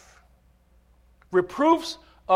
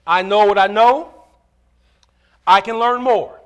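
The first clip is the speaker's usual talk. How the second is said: loud, raised, declarative preacher voice; full-force preaching; 5 semitones higher, 10 dB louder than usual. Acted. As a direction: preach it out with emphatic, stretched phrases.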